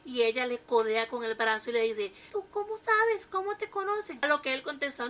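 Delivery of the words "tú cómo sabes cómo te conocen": high accusing question; the wife elbows him and asks; raised wife voice, suspicious. A narrator's reading.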